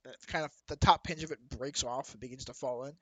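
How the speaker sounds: usual speaking voice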